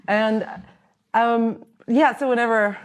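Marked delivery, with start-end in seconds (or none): none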